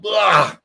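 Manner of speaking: mock retching noise; imitated vomiting. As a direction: spew out an imitated puking sound. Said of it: "I threw up".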